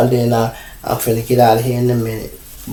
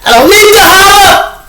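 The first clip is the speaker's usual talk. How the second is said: mock holler in a shrill voice far above his own, very loud; 10 dB louder than usual. Father mocking a loud guest at home: big mock yell. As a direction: act out a loudmouth yelling that he doesn't need to holler.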